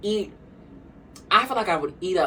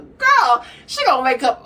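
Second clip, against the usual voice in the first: excited shriek; 9 semitones higher, 7 dB louder than usual. Real, not acted. Hyped reaction, shrieking.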